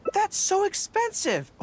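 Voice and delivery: high, slow astonishment; shocked. Real, not acted. [name] reacting to the cake price. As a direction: gasp at the price.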